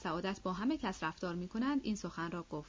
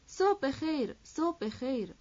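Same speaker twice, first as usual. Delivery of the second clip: higher-pitched cheerful hail; delighted greeting; 6 semitones higher, 3 dB louder, slower than usual. A narrator's reading. German host delightedly repeats his greeting. (سلام شادمانه، با صدای زیرتر)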